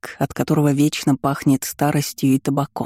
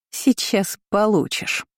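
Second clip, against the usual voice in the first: higher, slow, smug; gleeful threat; 5 semitones higher, slower than usual. A narrator's reading. Kiora gloats at her cousin as the aunt runs out, smug and slow. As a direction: promise trouble with a smirk. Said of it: "довольно хохотнула Киора".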